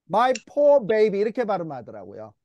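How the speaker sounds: normal talking voice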